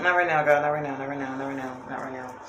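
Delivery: not right now, fading from normal to almost silent; fading refusal. Retold or imitated.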